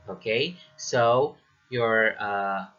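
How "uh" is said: ordinary talk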